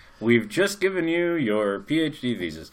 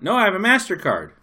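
playing dumb; 8 semitones higher, 6 dB louder than usual. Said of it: "I looked at her and I went".

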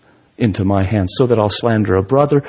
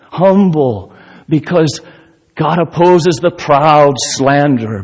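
preaching at full force; 5 semitones higher, 4 dB louder than usual. Acted. Preaching climax.

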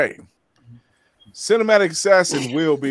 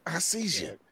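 breathy teasing whisper; 12 dB quieter than usual. Pretend. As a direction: whisper it playfully. Repeated teasing, whispered take.

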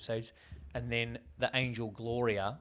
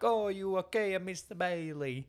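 put-on higher, light Italian-ish old man voice; a kindly barman checking on him, in a put-on accent; imitating Mr. Martini; 9 semitones higher than usual. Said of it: "Mister Martini is like".

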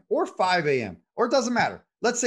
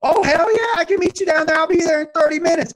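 much higher and louder, thrilled yell; suddenly energized; 7 dB louder than usual. Retold or imitated. Husband accepts golf invite, loud and thrilled. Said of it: "And he goes".

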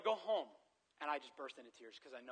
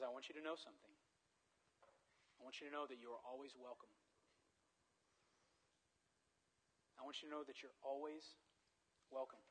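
tender assurance; 6 dB quieter than usual. Retold or imitated. Mom's tender assurance, retold.

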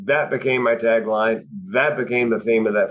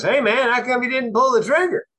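high-pitched mock letdown; disappointed; 13 semitones higher than usual. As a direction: imitate a friend's disappointed question, pitched way up. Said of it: "sound slightly less disappointed".

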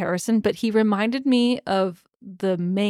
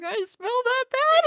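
much higher, squeaky mock horror; mortified squeak. Misery embarrassed about her smell.

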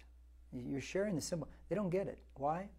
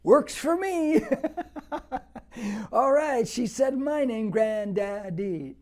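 higher, louder, laughing with joy; delighted laugh; 7 semitones higher, 9 dB louder, slower than usual. Real, not acted. Proud grandfather telling church about his granddaughter, delighted.

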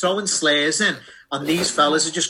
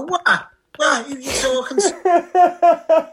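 high, loud, slow, laughing shout; mocking the dad's outraged phone reply; imitating the angry dad; 12 semitones higher, 4 dB louder, much slower than usual. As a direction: yell it like a furious half-deaf dad. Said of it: "in the fella's voice".

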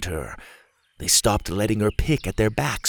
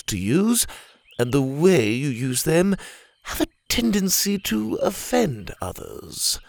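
polite lecturing, patient and measured; prim orangutan voice. Acted. Leopold's voice.

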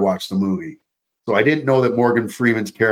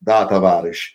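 mock Russian accent greeting a comrade; 5 semitones lower than usual. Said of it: "my Russian accent's actually pretty spot on".

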